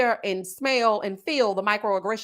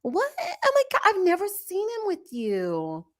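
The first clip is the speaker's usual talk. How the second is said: higher, incredulous gasping questions; shocked nurse; 7 semitones higher than usual. Retold or imitated. Nurse finding out she's married, hammed-up shock. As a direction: play her disbelief up high. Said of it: "she was so shocked".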